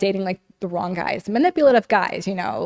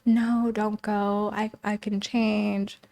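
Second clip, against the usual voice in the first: put-on pleading ex-boyfriend; 4 dB quieter, much slower than usual. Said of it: "I signed a lease".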